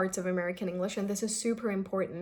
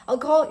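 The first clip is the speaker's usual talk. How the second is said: "I'll call" higher and louder, wrongly lifted; 5 semitones higher, 8 dB louder than usual. Shown as a demonstration. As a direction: overdo the pitch on it. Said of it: "you won't be doing something like this".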